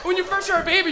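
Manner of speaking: normal talking voice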